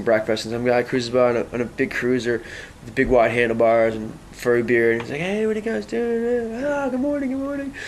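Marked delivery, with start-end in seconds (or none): 5.2-7.7 very high, soft put-on greeting; imitated high, lilting hello; friendly passing cyclist. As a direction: mimic a chirpy passer-by in a high voice.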